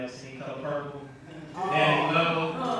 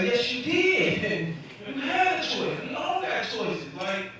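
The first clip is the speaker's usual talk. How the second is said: exasperated lecture; 9 semitones higher than usual. Retold or imitated. Comic lectures uncle.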